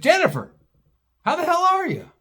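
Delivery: high, bright-eyed call across the rail; delighted greeting. Retold or imitated. Greeting an old friend at track.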